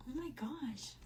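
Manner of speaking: breathless whisper to herself; stunned whisper of disbelief; whispered thought. Retold or imitated. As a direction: gasp it under your breath like a secret. Shocked inner thought at touch.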